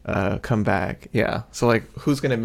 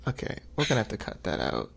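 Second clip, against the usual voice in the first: ordinary delivery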